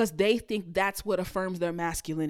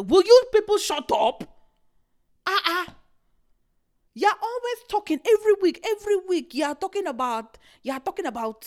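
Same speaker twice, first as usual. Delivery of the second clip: louder and higher, fed up with everyone; exasperated outburst; 9 semitones higher, 6 dB louder than usual. Real, not acted. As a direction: snap at the noisy crowd, fast and loud. Snapping at social media chatter.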